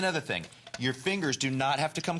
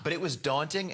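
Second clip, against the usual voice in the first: ordinary talk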